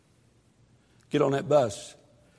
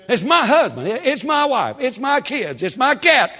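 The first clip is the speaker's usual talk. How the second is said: high, loud mocking whine; whiny excuse-maker; 11 semitones higher, 7 dB louder than usual.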